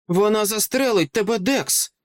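high-pitched, alarmed cry without full volume; somewhat frantic cry. A narrator's reading. Sister warns her brother of a gun, crying out frantically. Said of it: "закричала Деп дещо шалено".

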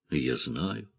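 quietly, almost under the breath; тихо. A narrator's reading. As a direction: say it low and hushed. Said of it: "вдруг тихо произнесла она". (тихо, почти про себя)

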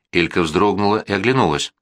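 plain reading voice

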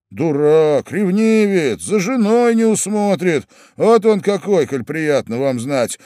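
high, heated insults; angry mockery. Young man tells what the jealous husband is, angry mockery.

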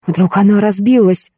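plain reading voice

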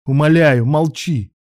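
high, loud, urgent begging; pleading shout. A narrator's reading. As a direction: beg loudly for silence. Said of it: "закричал Илья".

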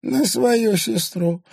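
put-on high, slow old crone voice; old crone breathing out. A narrator's reading. Old crone exhales at the girl, high and slow. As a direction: breathe the words out like a frail old woman. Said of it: "выдохнула старуха".

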